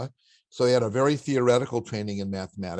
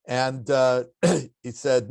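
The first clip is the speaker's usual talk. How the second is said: usual speaking voice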